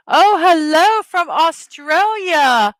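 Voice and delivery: bright, slow, loud hello; excited greeting. Real, not acted. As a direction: greet them with delight. Greeting a new viewer.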